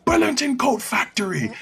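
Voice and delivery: imitating a Jamaican reel, aggressive accent. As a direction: bark the store name in a Jamaican accent. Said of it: "it sounded like the most aggressive".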